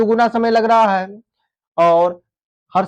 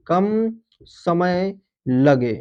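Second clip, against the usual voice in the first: कम समय लगे drawn out word by word; slow emphatic repeat; 2 semitones lower, much slower than usual. Shown as a demonstration. Teacher repeating the definition for students, very slow and deliberate. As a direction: stretch out कम समय लगे slowly so students note it. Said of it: "जिनके उच्चारण में".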